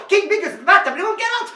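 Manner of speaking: high, breakneck gabble; super duper fast. Shown as a demonstration. Storyteller pushing the children's speed, super duper fast. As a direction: cram it into one frantic burst. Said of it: "Super duper fast".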